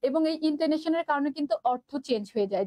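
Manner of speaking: ordinary delivery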